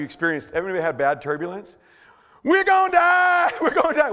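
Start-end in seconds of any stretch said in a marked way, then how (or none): 2.4-4.1 high, loud hammed-up scream; panicked shout. Passenger in bad turbulence, hammed-up panicked shout. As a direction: scream it in mock terror.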